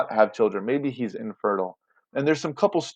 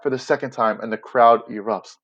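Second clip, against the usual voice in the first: ordinary talk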